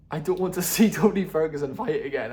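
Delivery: laughing loudly through the line. Real, not acted. Words spilling out through a laugh. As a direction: vlogger cracking up over Tony Ferguson, laughing loudly. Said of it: "That was so funny".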